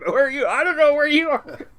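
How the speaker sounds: high, loud lost cop calling through the boat. Retold or imitated. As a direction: call out laughing, lost below decks. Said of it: "They were calling".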